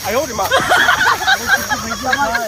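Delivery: long high wail of pain. Acted. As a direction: cry out as you fall. Falls while drunk.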